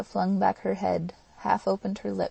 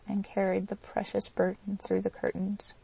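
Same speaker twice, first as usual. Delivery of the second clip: ordinary delivery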